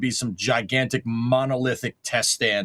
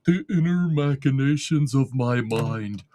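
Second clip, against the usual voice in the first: Patrick quote. Retold or imitated. Quoting Patrick on his worn-out brain, in a light dazed voice. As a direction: drift through it like a dazed starfish, only lightly. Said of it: "Patrick in that episode of SpongeBob where he's like".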